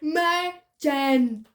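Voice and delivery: "Imogen" drawn out, syllable by syllable; slow syllable-by-syllable correction. Shown as a demonstration. Correcting sister's reading, slow and deliberate.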